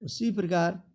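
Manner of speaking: normal talking voice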